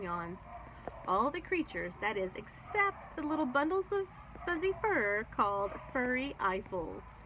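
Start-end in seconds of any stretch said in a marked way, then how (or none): none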